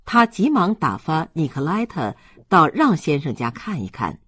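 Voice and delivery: usual speaking voice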